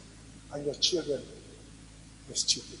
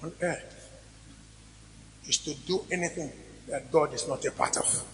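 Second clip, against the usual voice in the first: preaching. Real, not acted. Pastor exhorting his congregation about God and children, fervent preaching. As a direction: preach it, hammering each point home.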